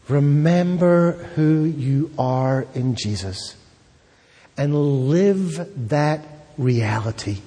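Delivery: ordinary talk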